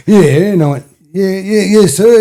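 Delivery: high, loud hammed-up yes sir; mock meek yes sir. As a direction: squeak out deferential replies. Boy answering policeman.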